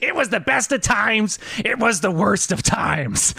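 high, put-on narrator reading; weird audiobook voice. Joking he'd sneak a chapter into Andy's audiobook, weird narrator voice. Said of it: "Why does he sound so weird in chapter three".

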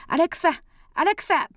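put-on loud high child's call. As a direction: shout for Alexa like a kid. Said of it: "友達の子供が".

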